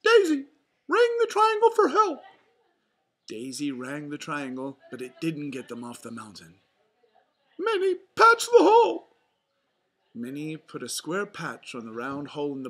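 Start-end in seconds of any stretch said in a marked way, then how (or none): 0.0-2.2 very high, loud falsetto mouse; rally friends in a cartoon falsetto; Mickey Mouse. A narrator's reading. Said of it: "said Mickey". 7.6-9.1 very high, loud falsetto mouse; rally friends in a cartoon falsetto; Mickey Mouse. A narrator's reading. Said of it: "yelled Mickey".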